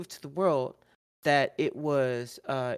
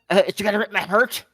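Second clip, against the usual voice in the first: fast, loud, higher mock line; mocking quote; 5 semitones higher, 7 dB louder, faster than usual. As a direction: rattle it off in a mocking voice. Mimicking the promo line.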